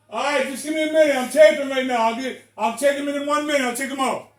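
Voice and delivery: telling his wife he's taping; calling across the room. Real, not acted. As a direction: project it to the next room.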